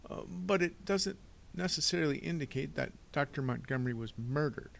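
high-pitched, fussy banker; hesitant Mister Poe. Acted. Banker conceding the boy's point, hesitant.

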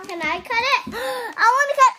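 squealing with excitement; delighted opening a present. Real, not acted. Child excited to open toy.

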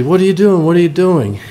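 a retold, lightly hammed-up gasp of surprise; shocked parents. Shocked parents voiced in a joke.

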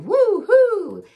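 loud high woo-hoo; excited whoop. Acted. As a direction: cheer it out loud. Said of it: "he cried".

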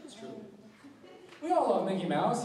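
ordinary delivery

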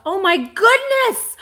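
high, loud and drawn-out gasp of delight; overwhelmed by how pretty the wrapping is; delighted surprise. Real, not acted. Seller thrilled by Dolores's wrapping, a high loud slow cry of delight.

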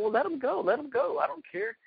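cocky dare tossed at the rival's trash-talking crew; mocking dare. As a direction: brush off the threat with a sneer.

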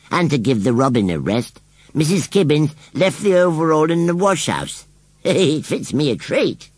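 high rustic voice with a chuckle; airy rustic scarecrow. A narrator's reading. Scarecrow shows off borrowed overall, airily pleased.

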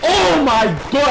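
surprised. Real, not acted. Sudden shock at hit.

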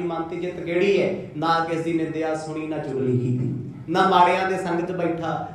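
ordinary talk

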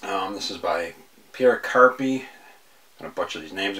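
usual speaking voice

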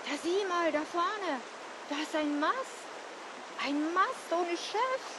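quietly puzzled lookout; hushed amazement. A narrator's reading. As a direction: point out a strange sight under your breath. Johannes spots something in the dark.

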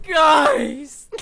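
loud high startled cry to chat; startled shout. Real, not acted. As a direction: yell out in shock. Streamer startled by mic flying.

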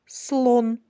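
слон said clearly as one syllable, chin dropping once; word modelled for syllable count. Shown as a demonstration. Teacher models слон for children counting syllables. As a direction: say слон slowly as one beat. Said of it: "промовте слово".

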